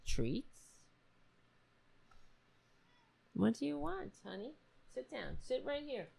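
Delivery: high, hushed coaxing to the dog; soft pet talk. Real, not acted. Talking to the restless pet at home, high hushed coaxing. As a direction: sweet-talk the fussing pet softly.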